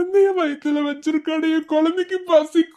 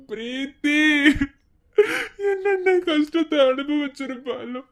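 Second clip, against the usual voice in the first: crying. Acted. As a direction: wail through tears, breaking off into long tearful pauses. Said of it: "ஆனா அழாதீங்க". (அழுதுகொண்டே புலம்பல்)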